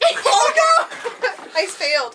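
high, loud laughing cry; laughing dismay. Real, not acted. Asked how much beer is left, laughing dismay. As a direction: squeal it through a laugh.

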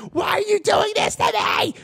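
high screaming wail; hysterical shout. Retold or imitated. Storyteller imitates the rock star's floor meltdown with a high scream. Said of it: "slamming her fucking fist ... shaking her head like".

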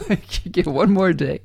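amused, laughing through the words. Real, not acted. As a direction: let a real laugh spill into the line.

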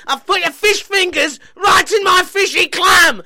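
screaming furious rant, high and loud; swearing tirade. Acted. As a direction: shriek abuse at her, unhinged. Ashley screaming at Brittany.

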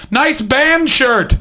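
high, slow, dopey car heckler voice; mock heckler. Stranger yelling from a car, high slow goofy voice. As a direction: drag it out like a goofy passer-by.

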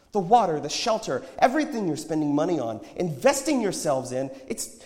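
high, loud, urgent exhortation; impassioned prophet. A narrator's reading. Prophet warns the shoppers about illusions, impassioned. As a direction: preach at them with rising fervour.

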